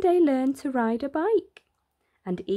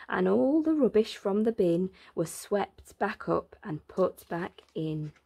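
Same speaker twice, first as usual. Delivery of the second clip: usual speaking voice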